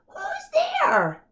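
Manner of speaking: very high mouse cry; scared little mouse calling out; tiny high mouse voice; squeaky mouse cry. Acted. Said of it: "Mouse cries".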